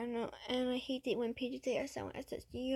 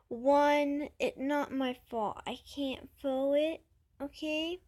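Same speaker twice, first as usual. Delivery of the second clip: ordinary talk, nothing marked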